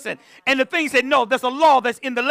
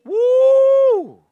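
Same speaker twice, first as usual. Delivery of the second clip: high, ringing whoop; a sudden high praise holler; preacher's whoop; 13 semitones higher, 6 dB louder than usual. Real, not acted. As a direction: let out a celebrating whoop to the church. Preacher's whoop mid-sermon.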